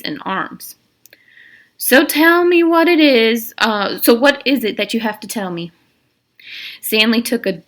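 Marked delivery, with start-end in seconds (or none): 1.8-5.7 warden's voice. Acted. Warden questions Stanley.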